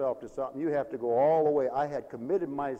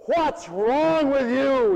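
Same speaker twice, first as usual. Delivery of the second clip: louder, slow drawn-out yell; 10 semitones higher, 9 dB louder, much slower than usual. Retold or imitated. Boy yelling at friend with stick, slow loud yell. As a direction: holler it at your friend. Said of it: "he yells".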